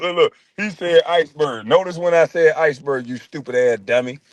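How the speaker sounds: ordinary talk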